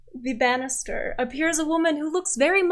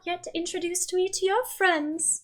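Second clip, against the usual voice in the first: higher, mock-offended dramatic mother; a lady chiding her daughter playfully; theatrical mother; 6 semitones higher than usual.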